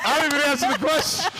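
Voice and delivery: high, quick, cracking up; laughing protest. Real, not acted. Guest realising he never answered the host's question, laughing protest. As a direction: squeal it through laughter.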